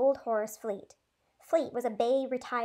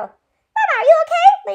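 much higher and louder frightened call; alarmed cry; 16 dB louder than usual. A narrator's reading. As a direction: cry out in panic to mama. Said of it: "Leno exclaimed".

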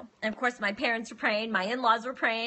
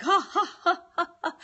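high, breathy mock sobs in bursts; mimicked heaving sobs; imitated convulsive crying; 6 semitones higher than usual. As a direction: act out his convulsive sobbing, exaggerated. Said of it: "He's, like, crying convulsively".

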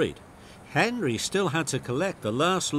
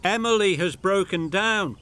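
station master with news; 7 semitones higher, 4 dB louder than usual. A narrator's reading. Station master brings message.

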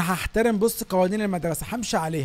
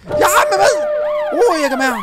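panicked yelp; 12 semitones higher, 7 dB louder than usual. Real, not acted. Panicked at the game teacher.

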